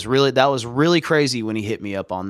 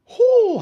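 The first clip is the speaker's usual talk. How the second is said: relieved whew; 6 dB louder than usual. Real, not acted. After clearing his throat.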